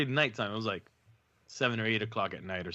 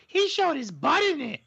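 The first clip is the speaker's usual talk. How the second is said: put-on much higher, tattling little kid; little girl voice; 4 dB louder than usual. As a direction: squeak it like a small child telling on you. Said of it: "the little one goes".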